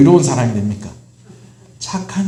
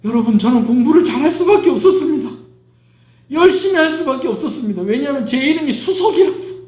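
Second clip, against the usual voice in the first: quoting the guest pastor, higher and brighter with a laugh; quoting a fellow pastor; 8 semitones higher than usual. Retold or imitated. Preacher quoting a fellow pastor's joke to the congregation, lightly amused. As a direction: retell his joke from the pulpit, lifting the pitch playfully. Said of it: "부흥회 할 때도 얘기를 하셨어요".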